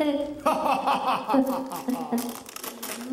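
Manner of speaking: staccato mock-villain laugh; long wicked ha-ha, hammed up; evil laugh. Shown as a demonstration. Showing the king how to laugh again, evil laugh. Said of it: "with your evil laugh".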